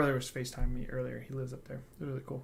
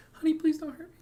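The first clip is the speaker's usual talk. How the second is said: very high, louder mock pleading; talking-to-wife voice; 14 semitones higher, 5 dB louder, faster than usual. Husband jokingly demonstrates his talking-to-wife voice, high and pleading. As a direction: plead in a jokey, pitched-up husband voice. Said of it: "talking to my wife voice".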